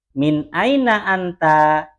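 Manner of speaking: slow, drawn-out "Min aina anta" in an asking tune; question intonation. Shown as a demonstration. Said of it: "intonasi berta".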